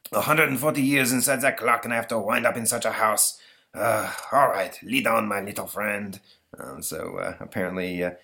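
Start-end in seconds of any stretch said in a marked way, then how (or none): 0.1-6.2 put-on grumbling French-accented emperor; aggrieved ghostly emperor; put-upon Napoleon. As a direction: complain grandly in a stagey French accent.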